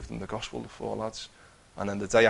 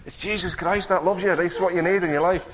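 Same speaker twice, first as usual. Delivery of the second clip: high, loud street shout; 9 semitones higher, 7 dB louder than usual. Retold or imitated. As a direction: call it out after them down the road. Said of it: "I'm shouting up the street".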